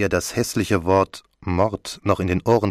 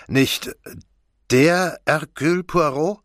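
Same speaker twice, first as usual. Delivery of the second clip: high, halting question with a rising end; stammering amazement; 9 semitones higher, slower than usual. A narrator's reading. Commissioner meets the famous Hercule Poirot, stammering in amazement. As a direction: break off, then ask slowly in disbelief. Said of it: "stammelte der Kommissar".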